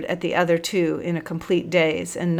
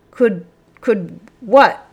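higher, louder, halting bluster; 4 semitones higher, 8 dB louder, much slower than usual. A narrator's reading. Busuku answering the angry sergeant, blustering. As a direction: stumble slowly through indignant confusion. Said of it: "blustered Busuku".

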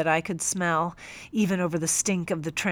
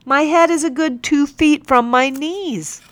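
higher and louder exasperated protest; frustrated complaint; 8 semitones higher, 8 dB louder than usual. Acted. Captain complaining to the teacher, frustrated and loud. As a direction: vent his frustration at the pose.

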